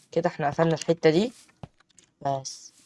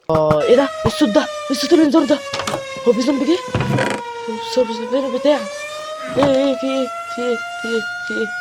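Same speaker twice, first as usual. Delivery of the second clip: panicked shouting; 9 semitones higher, 4 dB louder, much faster than usual. Real, not acted. Sudden zombie noise panic.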